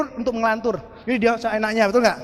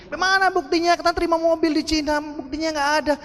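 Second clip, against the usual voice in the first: high-pitched sceptical needling; mock challenge; 6 semitones higher than usual. Doubters spread gossip about the car, high mocking challenge. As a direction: whine it like a doubter wanting proof. Doubters voiced demanding proof.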